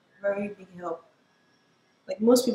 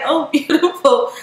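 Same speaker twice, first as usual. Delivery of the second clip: put-on high, loud gushing in a British accent; 7 semitones higher, 9 dB louder than usual. Teacher admiring student's print, imitated in a British accent. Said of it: "I'm supposed to do a British accent".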